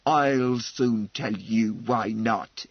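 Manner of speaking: low, slow, wrathful interruption; gathering wrath. Acted. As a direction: cut in slowly with rising anger. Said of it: "had been listening in gathering wrath".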